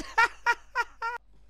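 high wild cackle; loud laugh. Real, not acted. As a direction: laugh it out hard.

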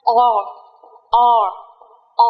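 long "o" drilled three times; teacher drilling the long o vowel; model vowel sound. Shown as a demonstration. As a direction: hold the "o" long. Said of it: "Âm tiếp theo là âm o dài".

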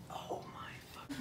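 breathless unvoiced whisper; stunned whisper. Real, not acted. [name] stunned by his new piercing, whispering. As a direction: whisper it in disbelief.

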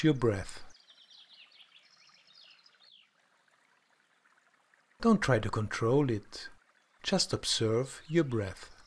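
calm, soft guided meditation voice; soft spa whisper. Acted. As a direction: guide them gently into stillness.

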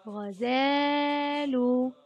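"غزال" with a long, held aa; "غزال" drawn out very slowly on the alif; lengthened fatha. Shown as a demonstration. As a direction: stretch the middle of "غزال": ghazaaaalu. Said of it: "أطال صوت الفتحة قليلًا".

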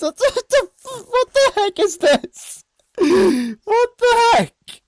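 very high, drawn-out squeal; real high-pitched startle; startled shock. Real, not acted. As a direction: squeal in disbelief, stretching the words. Startled by something in game.

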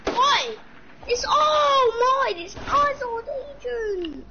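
high slow crying wail; crying shriek. Real, not acted. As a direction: shriek it like a tantrum. Shrieking over loot.

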